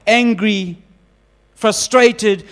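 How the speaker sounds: ordinary delivery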